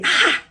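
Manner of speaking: sharp high "Ah!" of shock; taken aback by a cruel video; shocked gasp. Real, not acted. Shocked at labeling trend.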